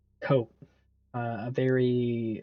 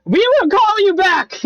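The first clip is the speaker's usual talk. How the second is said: much higher, louder frantic cry; panicked shout; 9 dB louder than usual. Acted. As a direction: yell in sudden panic. Panicked voice hangs up.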